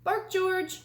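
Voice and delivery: mother telling her puppy to bark; mother's command. A narrator's reading. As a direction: call it out like a patient mom. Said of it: "George's mother said".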